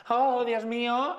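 high, slow melodramatic "oh dear"; soap-opera shock; overacted gasp. Shown as a demonstration. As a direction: ham up a fake fright, stretching it out. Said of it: "para que no sea".

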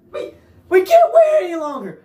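high, loud whiny cry; mock wail. Real, not acted. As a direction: wail it like a desperate fan. Whining at Sony.

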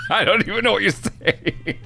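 high, helpless laughter through the words; laughing. Real, not acted. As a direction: crack up while speaking. Cracks up at tongue-tied co-host.

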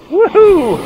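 ordinary delivery

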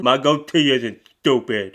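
loud put-on voice, stressing goatee; hammed-up wounded pride; offended boyfriend. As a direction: defend the goatee like your honour's hurt.

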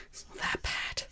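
soft whispered reassurance. Real, not acted. Whispering comfort to her drawn vampire. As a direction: breathe it out as a secret comfort.

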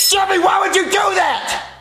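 slow, high-pitched yell of disbelief; exasperated shout. Acted. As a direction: drag out the shocked reproach. Father scolding son at dinner.

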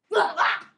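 sudden burst of laughter; loud laugh. Real, not acted. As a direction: crack up loudly. Bursts out laughing after song.

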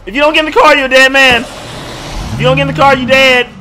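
loud, high threatening shout; yelling. Real, not acted. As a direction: scream the threat at the survivor. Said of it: "killing you guys' eardrums".

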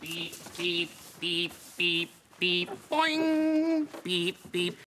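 monotone robot voice with bouncy boings; playful robot. Pretend. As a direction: speak like a friendly toy robot on one note. Playing a robot named Teo.

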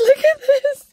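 high laughing squeal; giddy squeal. Real, not acted. Showing viewers the roll, giddy squeal.

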